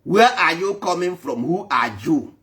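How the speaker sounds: normal talking voice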